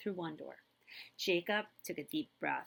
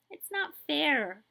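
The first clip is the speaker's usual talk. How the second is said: tearful; 7 semitones higher, 7 dB louder, slower than usual. Acted. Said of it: "Sophie started to cry again".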